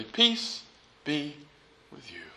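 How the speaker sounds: very soft, spaced-out blessing, sinking low; simple blessing. Acted. Jesus forgiving his disciples instead of berating them, hushed and gentle. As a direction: say it gently, pausing between words. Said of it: "what he says is simply".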